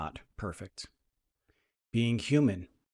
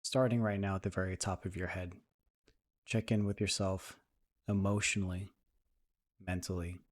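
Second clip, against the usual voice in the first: soft, even meditation voice with long pauses; guided meditation; 4 dB quieter than usual. Acted. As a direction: ease listeners into calm, leaving space between phrases.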